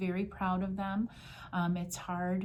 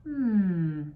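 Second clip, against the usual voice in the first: long, loud, falling "hmm"; pondering hmm; 6 dB louder than usual. Acted. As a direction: draw out a thoughtful hum. Policeman ponders the boy's answer.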